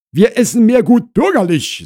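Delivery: high, loud housewife voice; Frau Krause explaining. Acted. Wife tells visitor their food habits, high and emphatic. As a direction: state it firmly at high pitch.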